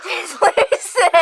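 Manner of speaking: laughing through the word. Real, not acted. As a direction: crack up while saying it. Laughing at a see-through kitten.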